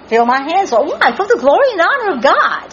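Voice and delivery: rising, quickening prayer fervour; fervent preaching. Acted. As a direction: voice climbing high into praise.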